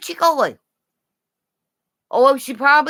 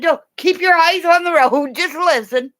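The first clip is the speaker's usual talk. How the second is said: retold telling off; 7 semitones higher, 3 dB louder than usual. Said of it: "I said".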